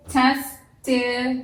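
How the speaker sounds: "Testimony" said slowly, all four syllables sounded apart; syllables drawn out. Shown as a demonstration. Teacher drawing out "Testimony" for her class, slow and syllable by syllable. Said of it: "Can you hear all four come through?".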